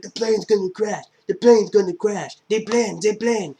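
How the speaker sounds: high, loud panicked scream about the crash; panicked yell. Retold or imitated. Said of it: "He's like".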